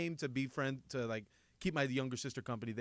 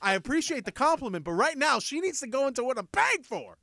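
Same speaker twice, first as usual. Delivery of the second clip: firm dad; 8 semitones higher, 5 dB louder than usual. Retold or imitated. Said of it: "I'm like".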